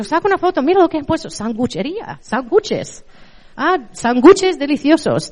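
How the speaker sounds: retold delight, pointing at a sign; excited retelling.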